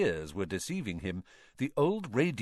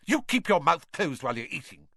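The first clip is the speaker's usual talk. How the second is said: snapped; 6 semitones higher, 6 dB louder than usual. A narrator's reading. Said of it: "Missus Weasley snapped".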